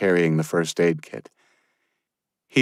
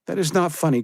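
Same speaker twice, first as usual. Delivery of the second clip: tearful; 11 semitones higher, faster than usual. Acted. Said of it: "as tears welled in her eyes".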